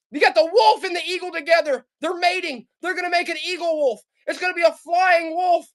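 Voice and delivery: selling the eagle-wolf shirt with a building shout; joking hype. Real, not acted. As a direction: hype it up to a silly yell.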